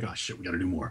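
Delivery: quiet, low mock excitement; a hushed take on the friend's reaction; muttered imitation of his buddy; imitating friend. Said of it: "you're like".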